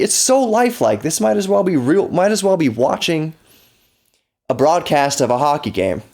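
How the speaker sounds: high-pitched, hammed-up awe; retold amazement. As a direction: relive being wowed, voice lifted high. Retelling old awe at lifelike graphics.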